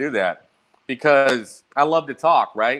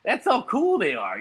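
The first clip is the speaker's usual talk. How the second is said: mock awe; 5 semitones higher, slower than usual. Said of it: "And I was like".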